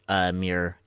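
ordinary talk, nothing marked

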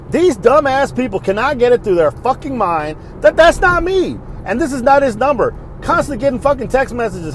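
high-pitched, worked-up complaint; worked up and fed up; exasperated rant. Real, not acted. Ranting about wrong-number texts.

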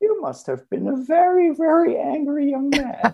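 very high, thin old-lady impression; ancient lady. Front-desk lady remarking on the student's tattoos, ancient lady. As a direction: imitate an old lady far up in pitch. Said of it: "this lady then, on the second day".

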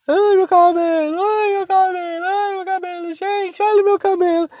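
very high, slow crying whine; hammed-up bawling, every phrase stretched out; mock wailing. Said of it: "o choro dela era um negócio assim". (choro debochado, agudo e arrastado)

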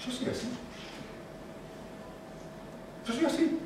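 small, high, soft voice; hushed mock helplessness; typical excuse-maker. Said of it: "la típica persona que dice".

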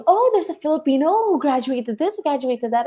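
high-pitched imitation of shocked onlookers; mock shock. People abroad reacting to a Filipino graduate, mock shocked. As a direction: act out someone startled by the news. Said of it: "they get shocked with".

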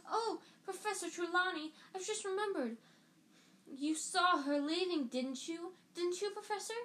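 put-on gushing girl. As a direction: squeal it to the teacher. Lavender gushing to teacher.

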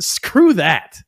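a laughing, scornful brush-off; laughing dismissal. Real, not acted. Laughing off splitting the purse.

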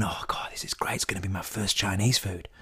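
hushed little whisper of wonder; whispering baby fox. Acted. Baby fox marvelling at its first Chinese food, whispered. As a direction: breathe it out in awe. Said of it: "The little baby one".

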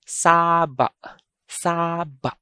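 saba with very long SAA, short cut-off ba; SAAA-ba, falling; stress on first syllable. Shown as a demonstration. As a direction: hold the first vowel of saba, stop the second short. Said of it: "I'm going to read".

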